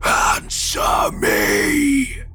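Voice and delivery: harsh, high, drawn-out rasping shout; monstrous demand. A narrator's reading. Gray man demands an answer from the driver, harsh snap. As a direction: stretch the last word into a rasping scream. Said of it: "he snapped".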